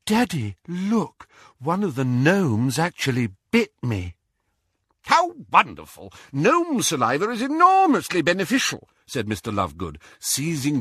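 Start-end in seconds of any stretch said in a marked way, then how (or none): 0.1-4.1 lightly voiced high daughter; a touch of girlish excitement, pitched up; excited daughter. A narrator's reading. Daughter showing Daddy her gnome bite, mildly excited. Daughter shows father bitten finger. 5.0-8.9 high, delighted exclamation; delighted eccentric father. A narrator's reading. Father to bitten daughter, high-pitched delight.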